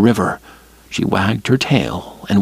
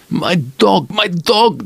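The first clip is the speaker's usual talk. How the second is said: high, strange keening voice; a lightly voiced stranger grieving his dog; mourning; 8 semitones higher, slower than usual. A narrator's reading. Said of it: "the strange voice mourned".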